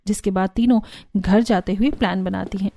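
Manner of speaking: ordinary delivery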